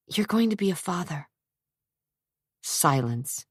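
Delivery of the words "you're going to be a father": Trisha, hushed. A narrator's reading. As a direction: tease him with a secret, softly. Trisha's voice in story.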